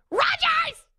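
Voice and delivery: very high, squealed shout; fangirl yell. Pretend. Host pretending to hail the quarterback, yelling. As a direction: yell the name in a high shriek. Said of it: "go on the field and yell".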